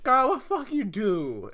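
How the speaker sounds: hammed-up scolding auntie voice; mock Medea. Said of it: "And he's like".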